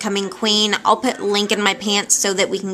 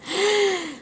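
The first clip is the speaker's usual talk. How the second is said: mock gasp; 11 semitones higher, 4 dB quieter than usual. Mock shock at losing aide.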